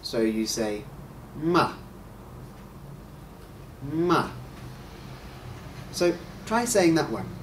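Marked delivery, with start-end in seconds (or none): 1.4-1.7 "ma" starting high and dropping straight down; falling fourth tone. Shown as a demonstration. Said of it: "high pitch and go straight to a low pitch". 3.9-4.3 "ma" with a quick high-to-low fall; falling fourth tone. Shown as a demonstration. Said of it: "high pitch and go straight to a low pitch".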